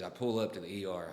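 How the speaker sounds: ordinary talk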